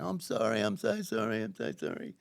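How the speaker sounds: high, rising plea; frantic apology. Retold or imitated. Woman running out with blood nose, frantic apology. She runs out apologising, bleeding.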